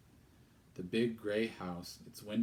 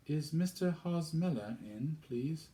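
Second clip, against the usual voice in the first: put-on high, timid girl's question; young girl's voice; 8 semitones higher than usual. Girl asks stern stranger at the door, high and polite.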